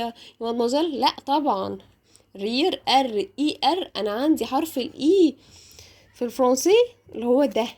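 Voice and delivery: ordinary talk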